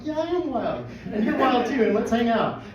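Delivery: high, loud, laughing enthusiasm; giddy excitement. Retold or imitated. Foreigner bonding with restaurant staff, giddily excited.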